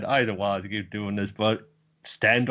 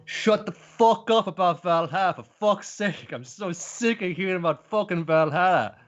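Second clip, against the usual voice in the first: high, exasperated telling-off; mock fed-up rant; 8 semitones higher than usual. Thorfinn fed up with talk of Valhalla, high and exasperated. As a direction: spit out the complaint in a raised voice.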